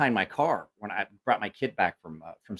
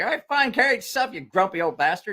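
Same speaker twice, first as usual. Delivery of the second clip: mock exasperated; 8 semitones higher than usual. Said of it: "And I was like".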